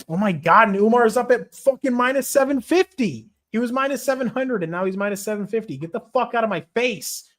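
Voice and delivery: high-pitched disbelieving complaint; exasperated outburst. Real, not acted. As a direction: let outrage push the voice way up. Odds worsen as he bets.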